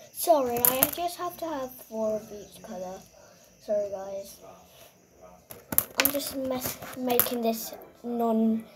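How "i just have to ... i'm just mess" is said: ordinary talk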